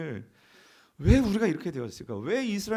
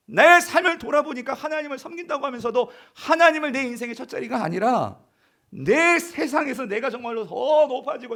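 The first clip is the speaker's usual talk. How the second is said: loud, higher heartfelt confession; fervent prayer; 4 semitones higher, 5 dB louder than usual. Acted. Preacher voicing a believer's confession before God, fervent. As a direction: pray it out with fervor.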